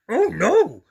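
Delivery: loud, high dismayed monster voice. A narrator's reading. Grover dreading the rain to Harry, loud and dismayed.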